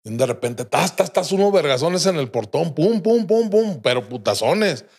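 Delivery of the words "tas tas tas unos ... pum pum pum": door pounding imitation. Banging on the gate.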